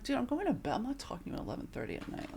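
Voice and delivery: retold brush-off to a late caller; dismissive retort. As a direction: rattle it off, shutting him down. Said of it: "I'm like".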